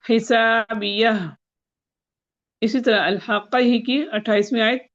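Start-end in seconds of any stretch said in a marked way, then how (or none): 0.0-1.1 حسابیہ spoken slowly with the final ہ held silent; slow teaching demo of حسابیہ; slow, drawn out. Shown as a demonstration. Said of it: "بیس اور چھبیس میں".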